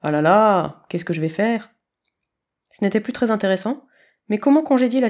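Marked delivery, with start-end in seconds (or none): 0.0-1.7 mock despair. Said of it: "singea-t-il".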